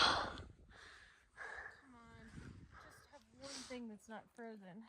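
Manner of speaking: faint, tired pleading; barely voiced from exhaustion; exhausted muttering. Real, not acted. Pleading with frozen gear.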